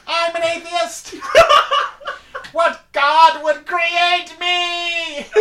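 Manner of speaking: high, laughing mock outrage; laughing shout. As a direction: cackle it out with theatrical dismay.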